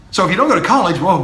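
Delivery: ordinary talk, nothing marked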